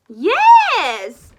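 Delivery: high, loud teacher call from the sky; a big booming hello from above; Madam Dragon calling out. Acted. Teacher calling to her class high above. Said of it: "said Madam Dragon".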